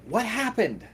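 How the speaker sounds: high frantic calling; old lady shouting from off screen. Acted. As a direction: demand answers in alarm. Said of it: "You hear her shouting".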